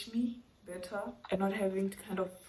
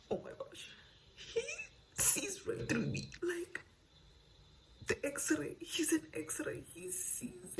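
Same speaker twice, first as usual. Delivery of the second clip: hushed, swooning whisper; breathless whisper; 11 semitones higher, 6 dB quieter, slower than usual. Retold or imitated. Reader mocking the heroine, in a swooning whisper. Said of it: "and she'll be like".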